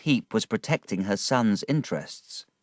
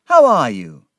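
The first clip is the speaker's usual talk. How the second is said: loud glad greeting; 4 semitones higher, 12 dB louder than usual. A narrator's reading. David greets Peggotty.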